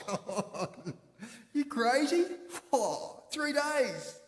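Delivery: high, loud mocking voice with laughs; scoffing disbelief. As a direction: choke on your coffee and scoff at the idea. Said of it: "they're scoffing at the suggestion".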